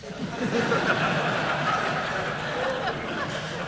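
a short amused laugh at his own punchline; breathy high laugh. Real, not acted. Preacher laughing at his own line to the congregation, amused. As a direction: laugh at the surprise you just sprang.

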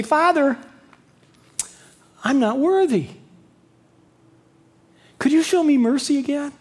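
a high, humble plea for mercy; pleading son. Retold or imitated.

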